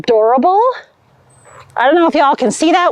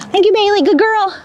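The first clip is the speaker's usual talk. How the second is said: high, sing-song praise for a pup; talking to a dog; 7 semitones higher than usual. Real, not acted. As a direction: coo at the dog.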